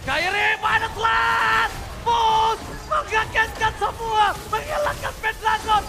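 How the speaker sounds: stretched, roaring hype call; fighting game announcer. Real, not acted. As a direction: scream the highlight like a finals caster. Caster erupts at triple kill.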